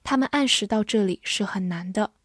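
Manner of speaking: usual speaking voice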